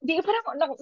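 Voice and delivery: ordinary delivery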